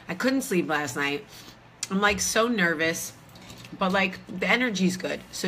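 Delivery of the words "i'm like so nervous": ordinary delivery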